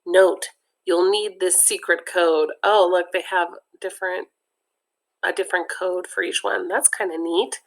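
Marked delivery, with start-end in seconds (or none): none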